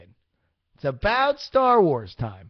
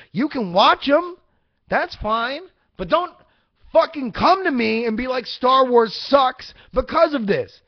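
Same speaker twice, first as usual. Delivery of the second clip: high and loud, playfully heated; a hammed-up big-brother rant, pitched up; mock angry rant; 7 semitones higher, 4 dB louder than usual. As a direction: scold the kid brother, half joking. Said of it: "Now I'm getting angry".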